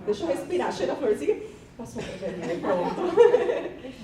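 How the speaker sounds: high nervous giggling through the words; nervous laughter. Real, not acted. Teacher laughing at her own nerves in front of colleagues, high and giggly. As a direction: laugh off the nerves, pitch jumping up. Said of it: "tô nervosa".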